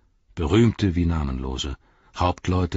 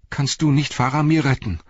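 desperate plea; 8 semitones higher, 3 dB louder than usual. Acted. Pippin begs Gandalf.

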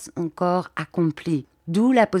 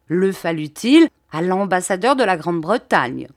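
indignant protest; 3 semitones higher, 3 dB louder than usual. Acted. Bobby protests his rights.

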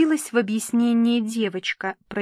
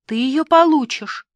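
higher, louder assurance; gushing promise; 5 semitones higher, 7 dB louder than usual. A narrator's reading. Papa promises the bride her veil, gushing indulgently. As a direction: promise it effusively.

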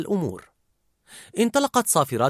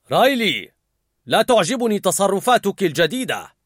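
louder, higher stern warning; stern dad; 6 semitones higher, 4 dB louder than usual. A narrator's reading. The father scolding Riley, stern. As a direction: warn her firmly. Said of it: "فقال الأب".

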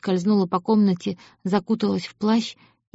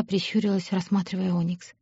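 ordinary delivery, nothing marked

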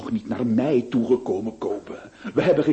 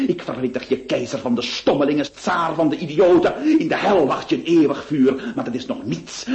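ordinary delivery

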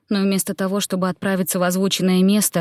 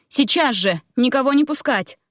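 urgent, higher-pitched order; commanding; 6 semitones higher than usual. A narrator's reading. Queen ordering guards to admit nobody, commanding. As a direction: give the guards a firm command. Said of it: "приказала им".

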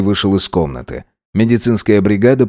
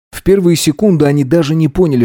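ordinary delivery, nothing marked

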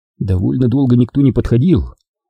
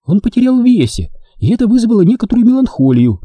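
higher woman's voice; nurse; 11 semitones higher than usual. A narrator's reading. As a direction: answer briskly. (медсестра)

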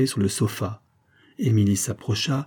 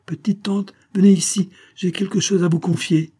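very high-pitched summons; calling out; 9 semitones higher, 4 dB louder than usual. A narrator's reading. Émilie calling her aunt to confide something, high call. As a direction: call your aunt over, voice pitched right up.

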